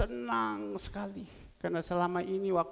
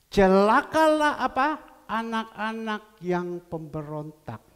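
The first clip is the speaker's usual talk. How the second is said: booming proclamation; 2 semitones higher, 11 dB louder, slower than usual. Acted. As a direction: proclaim the curse with full weight. Reads scripture loudly.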